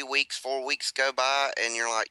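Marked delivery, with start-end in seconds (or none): none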